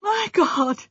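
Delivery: high, aghast exclamation; appalled as it sinks in; horrified. A narrator's reading. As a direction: gasp it out in shock. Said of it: "all the horror of it".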